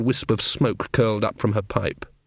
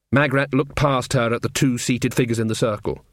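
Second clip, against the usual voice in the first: ordinary delivery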